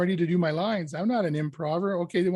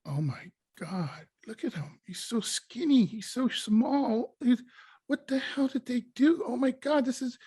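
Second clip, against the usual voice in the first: hushed, higher, half-whispered amazement; awestruck gasp; 7 semitones higher than usual. Retold or imitated. Actor on set staring at Hoffman as Capote, awestruck hush. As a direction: gape at him in stunned disbelief. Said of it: "and I'm just like".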